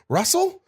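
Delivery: "Russell" swooping sharply up in pitch; questioning tone. Retold or imitated. Imitating a voice behind the caller, "Russell" as rising question. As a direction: ask "Russell" on a high rise. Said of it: "somebody else in the background".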